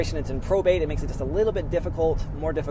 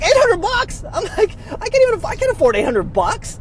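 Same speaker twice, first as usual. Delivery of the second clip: much higher, loud incredulous cry; shocked disbelief; 8 dB louder than usual. Retold or imitated. Vlogger reacting to his mortgage letter in loud, high shocked disbelief. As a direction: squeak out the outrage.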